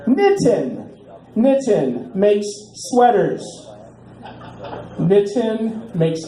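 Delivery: Nitin drawn out, high and slow, pushing the pun; punning on Nitin. Pretend. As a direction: stretch Nitin makes sweaters slowly to milk the joke. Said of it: "keep beating this into the ground".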